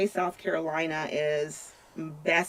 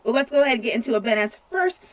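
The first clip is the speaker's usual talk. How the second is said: radio DJ voice; 5 semitones higher, 4 dB louder than usual. Acted. DJ announces the next song.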